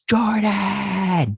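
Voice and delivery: high, drawn-out call; imitated yell for someone out of sight; mock yell. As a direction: call out the name high, without much volume. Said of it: "he just yells".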